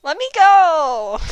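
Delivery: high, loud and slow protest; demanding shout. Acted. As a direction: strain against the ropes and yell. Tied up, demanding release.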